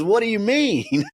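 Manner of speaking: high-pitched mock disbelief; retold indignant question, high; upset caller. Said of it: "was upset".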